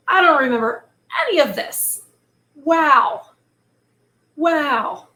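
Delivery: loud, high, thrilled wows; genuinely excited gasp of wonder; reacting to a shocking fairy tale, excited. Real, not acted. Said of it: "I'm getting a little too excited".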